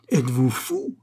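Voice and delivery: mild outraged cry. A narrator's reading. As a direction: call out the question in mild outrage. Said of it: "cria madame le huche".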